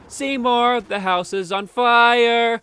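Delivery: hammed-up elderly mother alarmed; old mother calling. Skinner's mother calls out.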